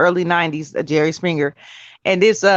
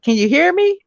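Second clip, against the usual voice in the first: pastor checking sound; 10 semitones higher than usual. Retold or imitated. Pastor testing mute on call.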